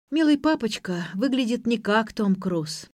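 ordinary delivery, nothing marked

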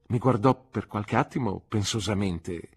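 plain reading voice

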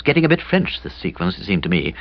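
usual speaking voice

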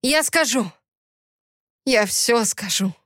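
strained cry sinking into defeat; hoarse surrender. A narrator's reading. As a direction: give in hoarsely, voice dropping. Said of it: "захрипел Кипер". (хрипло сдаваясь)

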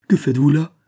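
horrified exclamation, acted; alarmed cry. A narrator's reading. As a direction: cry out in fright at what you see. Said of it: "s'écria Besmont au comble de l'effroi".

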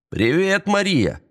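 high-pitched taunting call; shouted greeting. A narrator's reading. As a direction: call out her name high and drawn. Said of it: "крикнул Эстебан".

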